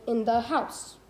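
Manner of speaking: in the house, weight on house; two light words, then land on house; anapestic stress. Shown as a demonstration. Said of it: "two unstressed syllables followed by one stressed".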